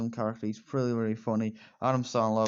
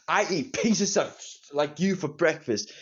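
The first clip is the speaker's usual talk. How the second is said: higher, slow, sneering villain taunt; a golf rival sneering a slow, higher insult; mock villain threat; 4 semitones higher, slower than usual. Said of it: "where Shooter McGavin says this".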